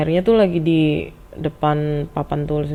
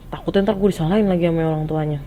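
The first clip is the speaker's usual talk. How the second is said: plain reading voice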